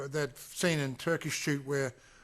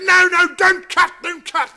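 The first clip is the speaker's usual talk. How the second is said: much higher, much louder panicked yell; frantic shout; 18 dB louder, slower than usual. Retold or imitated. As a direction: scream to stop her swinging the machete. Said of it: "He goes".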